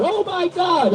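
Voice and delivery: high-pitched wail without volume; mock scream. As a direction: mimic panicked screaming, high but held back. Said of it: "y'all be screaming".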